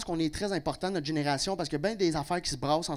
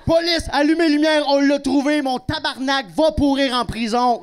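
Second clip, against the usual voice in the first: shout, high and loud, triumphant arrest; cri de policier; 7 semitones higher, 8 dB louder than usual. Acted. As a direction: yell like a cop nailing the suspect. Policier qui arrête l'humoriste. (crie comme un policier triomphant)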